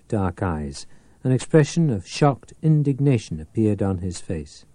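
ordinary delivery

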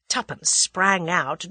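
plain reading voice

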